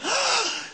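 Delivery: sharp high gasp of shock. Acted. Gasp in story.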